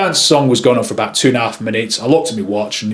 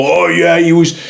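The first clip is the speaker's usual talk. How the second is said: higher put-on voice of a colleague; other photographer's voice; 5 semitones higher than usual. Photographer telling of a guest who wound him up, put-on voice. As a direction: imitate a fellow photographer, pitched up. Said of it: "he talked with this kind of".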